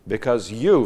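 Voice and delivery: "you" lifted high and loud; stress on "you". Shown as a demonstration. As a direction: lean hard on the word "you". Stresses the emphatic pronoun.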